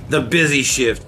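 ordinary talk, nothing marked

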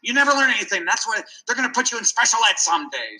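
imitating an impatient mother scolding her son; scolding mom.

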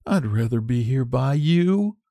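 a hint of young Sue, gently; lightly voiced young Sue. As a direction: say it as a friend staying close. Sue's voice in the story.

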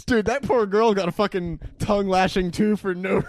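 usual speaking voice

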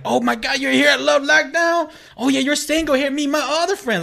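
high mock girly excitement; excited girls. Imitating excited girls at the club, high mock voices.

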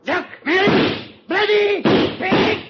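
furious shout. Acted. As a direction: roar the insult as you charge him. Charging at the armed Nazi.

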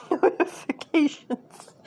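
laughing through the word. Real, not acted. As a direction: giggle out the word, embarrassed. Laughing at own notification mishap.

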